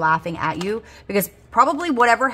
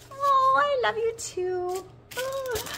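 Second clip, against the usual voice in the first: touched and tender; much slower than usual. Real, not acted. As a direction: melt at the kind note. Touched by a sweet note.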